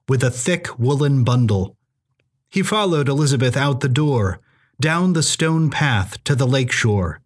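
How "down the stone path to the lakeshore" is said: ordinary delivery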